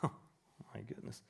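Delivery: breathy, very soft, slow aside under the breath; hushed amazed aside; whispered aside. Real, not acted. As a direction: murmur it almost to yourself in disbelief. Aside after mocking laughter.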